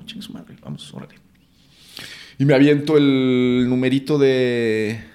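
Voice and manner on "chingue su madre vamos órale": unvoiced whisper to pump yourself up; whispered self pep talk. Actor whispers to himself before his Zoom casting. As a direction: hiss it quietly to yourself before the audition.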